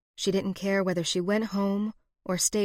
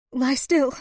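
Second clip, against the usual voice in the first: high, anxious command; fearful, voice cracking; 6 semitones higher, 4 dB louder than usual. A narrator's reading. Woman holding wounded man down, high fearful voice. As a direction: fear breaking through on the last word. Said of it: "the fear in her voice as it cracked".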